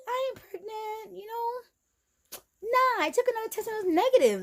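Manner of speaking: high-pitched dismissive denial; acted denial. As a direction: brush it off in a high voice. Acts out her past denial.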